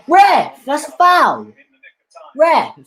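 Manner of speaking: shouted appeal to the ref, high; pleading with the referee. Real, not acted. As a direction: yell up at the ref that it's a foul. Yelling at the referee on screen.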